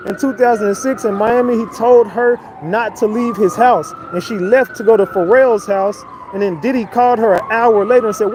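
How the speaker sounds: ordinary talk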